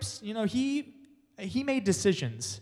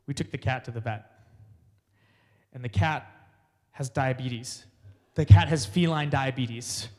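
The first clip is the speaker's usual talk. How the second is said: dad quote; 5 semitones lower, 4 dB louder than usual. Retold or imitated. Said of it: "was like".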